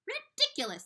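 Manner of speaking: high, loud shout. Acted. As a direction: shout him down in scorn. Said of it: "they shouted".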